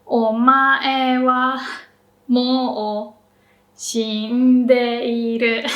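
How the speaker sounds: Omae wa mou shindeiru slowly, o and shi low; slow pitch demo. Shown as a demonstration. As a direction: spell out each pitch step slowly. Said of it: "only o is low".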